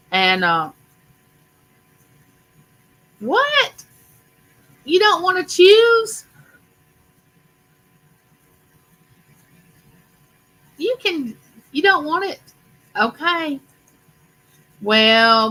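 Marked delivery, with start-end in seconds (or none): 3.2-3.8 sharply higher startled what; surprised. Real, not acted. Host reacting to a buyer who won't choose, startled. 4.8-13.6 surprised. Real, not acted. Buyer declines the gift.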